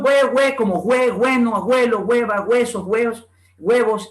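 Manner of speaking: BUENO, ABUELO, HUEVOS all begun with gwe; hue pronunciation. Shown as a demonstration. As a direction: turn "bue" and "hue" into güe. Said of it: "La pronunciación hue".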